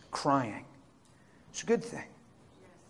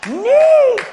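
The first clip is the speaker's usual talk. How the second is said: "Neat." squeaked much higher and louder, falling; dazed delight; 16 dB louder than usual. Retold or imitated. Coworker coming out of the prayer, squealing in dazed delight. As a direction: squeal "Neat." like a stunned, happy kid. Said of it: "He's like".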